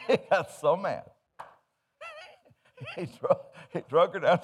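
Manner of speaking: laughing through the words. Real, not acted. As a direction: crack up while telling it. Laughing while telling.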